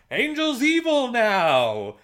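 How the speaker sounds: high, slow, loud alarm call; hammed-up Xander panic; mock panicked yell. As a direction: cry it out like a frantic warning.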